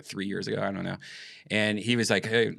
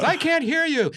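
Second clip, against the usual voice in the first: very high, loud mock comedian voice; Jerry Seinfeld; 4 dB louder than usual. Comedian on stage can't hear the question, high loud impression. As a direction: squawk it out as an exaggerated impression. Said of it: "He goes".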